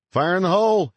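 high, loud shout to clear the area; urgent shouted call before a blast; yelled warning. A narrator's reading. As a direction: yell it out to the whole team. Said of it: "he yelled".

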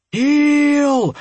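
high, loud desperate yell; shouting at the top of his lungs. A narrator's reading. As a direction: bellow it with everything you've got. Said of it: "kept on shouting at the top of his lungs".

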